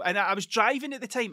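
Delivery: normal talking voice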